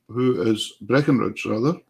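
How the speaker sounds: normal talking voice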